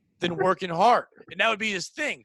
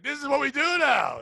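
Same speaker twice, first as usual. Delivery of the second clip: higher, excited mock shout; eager rookie; 7 semitones higher than usual. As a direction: play an overeager innocent, pitched up.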